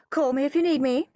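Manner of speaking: high, quick doctor's voice; quick parting call from the doctor; doctor character. A narrator's reading. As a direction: toss it off high and fast.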